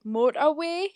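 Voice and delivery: "motorway" in Geordie, ending in "wee"; Geordie pronunciation. Shown as a demonstration. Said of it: "you have to say it like this".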